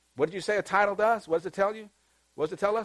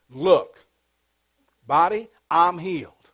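commanding the body; 5 dB louder than usual. Pretend. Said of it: "if you have to tell your body".

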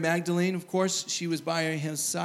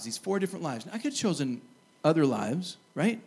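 ordinary delivery

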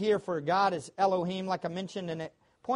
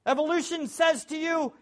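ordinary talk

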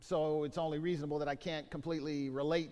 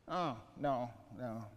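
normal talking voice

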